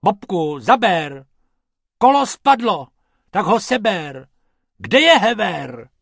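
high, loud bickering rabbit; loud high rabbits quarrelling at work; squabbling. Acted. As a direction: bark orders in a heated squabble. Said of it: "trochu se hádali".

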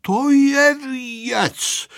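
high, very drawn-out marvelling; amazed neighbours. A narrator's reading. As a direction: stretch it out in astonishment. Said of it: "žasli sousedé".

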